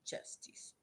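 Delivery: soft whisper to the dog. Real, not acted. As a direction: breathe the name to him.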